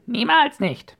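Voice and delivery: very high, loud parrot squawk; piercing bird shriek; screeching parrot. A narrator's reading. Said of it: "der Papagei kreischte".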